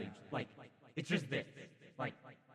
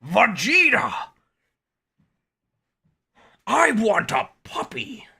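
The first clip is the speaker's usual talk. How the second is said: Frieza impression; 9 semitones higher, 13 dB louder than usual. Quoting Frieza lines.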